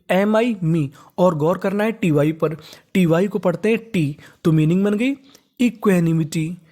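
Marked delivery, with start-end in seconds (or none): none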